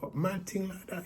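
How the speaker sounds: high worried question; scared patient asking his friend; alarmed. Retold or imitated. Friend scaring him.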